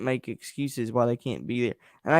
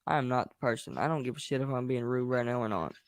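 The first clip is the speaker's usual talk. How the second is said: normal talking voice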